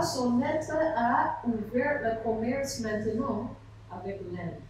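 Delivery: usual speaking voice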